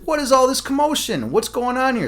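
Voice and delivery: high-pitched indignant queen; indignant demand. Acted. Queen walking in on a commotion, indignant demand.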